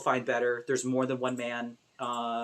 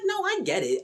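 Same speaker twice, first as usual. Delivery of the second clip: mock sympathetic. Voicing herself in the story.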